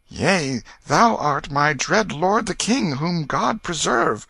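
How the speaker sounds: high, slow, reverent reply; humble frightened boy. A narrator's reading. Tom acknowledging his dread lord the king, high humble voice. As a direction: answer high and slowly, in awe.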